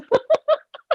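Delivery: high bursting laugh; burst of laughter. Real, not acted. Cracking up at the host's story, high burst of laughter. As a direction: break into a high laugh at the punchline.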